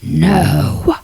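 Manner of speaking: low, far growled No like a brooding love interest; growling No. Pretend. Reader mocking a book hero's growl, low growl. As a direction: growl the word low in your chest. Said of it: "did he go".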